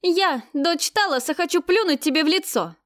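defiant, loud and high; дерзко. Acted. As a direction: spit the words in defiance. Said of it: "дерзко выступив вперёд". (дерзкий вызов)

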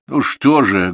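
resigned, mournful exclamation; sorrowful sigh. A narrator's reading. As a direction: heave it out with grief. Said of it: "горестно вздохнул Матвей".

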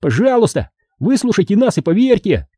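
high-pitched gnome plea; high, earnest little voice; pleading. A narrator's reading.

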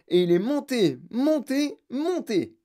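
each "monté" lifted high, like floating away; climbing pitch. A narrator's reading. Wonka telling how the Oompa Loompa rose, "monté" climbing high. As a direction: send "monté" up high and let it thin out.

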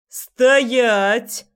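loud sharp bark in a strange voice; barked command. Acted. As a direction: snap it out like an order. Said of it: "рявкнул он не своим голосом".

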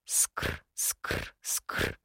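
whispered scraping noise; file scraping. Acted. Sound of file scraping metal.

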